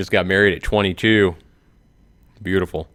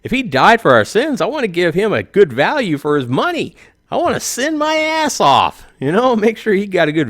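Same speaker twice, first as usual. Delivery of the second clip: mounting mock zeal; 11 semitones higher than usual. Comic arguing he owes God a good return, rising to a squeal. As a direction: pitch climbs higher and higher, building to a squeal. Building excitement on sinning argument.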